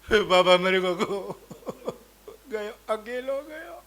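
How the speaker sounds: hammed-up mourner's keening; mock wailing. A mourning woman wails that he went alone. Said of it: "एक आयु रो, रो, रो के आ रही".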